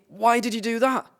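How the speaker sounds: high-pitched, dismayed question; betrayed frog. Acted. Drowning frog asks the scorpion why it stung, high-pitched dismay.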